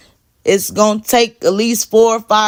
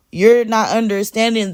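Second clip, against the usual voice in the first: ordinary delivery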